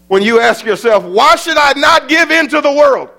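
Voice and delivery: high, loud shouted preaching; preaching at full force. Acted. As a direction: cry it out over the congregation. Rhetorical question preached.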